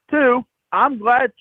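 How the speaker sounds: ordinary delivery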